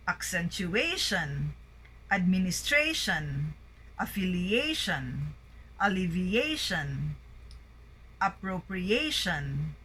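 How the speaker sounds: lighter stress on syllable two, main on four, as in abBREviAtion; words like "pronunciation", voice rising twice, peak on the fourth syllable; primary and secondary stress. Shown as a demonstration. Teacher reading out a word list, double stress with peak on syllable four. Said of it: "fourth syllable and the secondary stress on".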